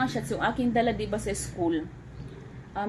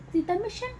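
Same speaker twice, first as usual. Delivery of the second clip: high sing-song; 9 semitones higher, faster than usual. Shown as a demonstration.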